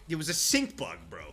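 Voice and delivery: ordinary talk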